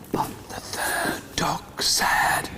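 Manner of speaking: slow, suspenseful stage whisper; hushed build-up. A narrator's reading. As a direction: whisper it slowly, setting up a surprise. Hushed build-up to big quack.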